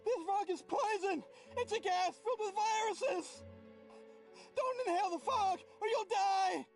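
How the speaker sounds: high frantic shouting; panicked yelling. Acted. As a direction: scream the warning in panic. Panicked NPC yelling fog warning.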